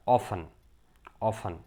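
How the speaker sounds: ઓફન as "OFF-un", without a T; silent T. Shown as a demonstration. Teacher modelling the word, silent T. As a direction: say ઓફન with the T dropped. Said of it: "ઓફન પણ બોલે".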